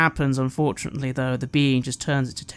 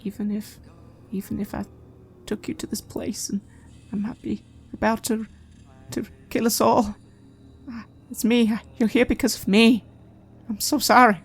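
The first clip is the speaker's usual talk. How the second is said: fragile plea; 6 semitones higher than usual. Acted. Nigel quietly asks forgiveness.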